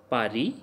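"Pari" ending on ee, no S; silent final S. Shown as a demonstration. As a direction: say "Pari" as pah-ree. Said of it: "we never pronounced S in the ending".

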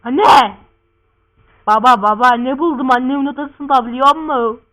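loud, urgent shout to parents; excited calling. Acted. Son telling father about a find in mother's room, excited shouting. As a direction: burst out with big news.

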